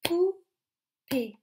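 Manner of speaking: "Poupée" high and loud, split "pou... pée"; teacher beating out "Poupée" in two loud syllables. Shown as a demonstration. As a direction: punch each syllable of "Poupée" separately. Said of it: "On va taper les syllabes ensemble".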